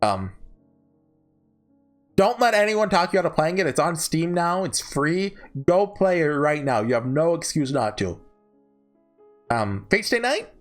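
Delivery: usual speaking voice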